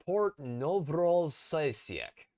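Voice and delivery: "Port Novorosiysk" sounded out slowly, syllable by syllable; careful pronunciation. Shown as a demonstration. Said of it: "let me try and pronounce this".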